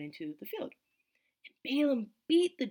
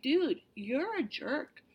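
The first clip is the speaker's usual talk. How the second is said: slow, higher, deadpan telling-off; mock scolding; 7 semitones higher, much slower than usual. The angel scolding Balaam, slow, mock blunt rebuke.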